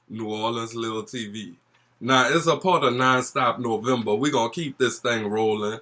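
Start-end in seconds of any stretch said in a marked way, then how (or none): 2.0-5.8 loud, fast gossipy hype; breathless gossipy storytime. Acted. Energetic storytime intro.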